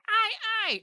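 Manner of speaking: loud squawking bird cry; bird call. Pretend. Game master imitating birds the players hear, squawking bird call. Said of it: "you hear birds going".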